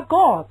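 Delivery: "God" with a rounded British o. Shown as a demonstration. Teacher contrasting British God. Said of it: "而美国人又不发God".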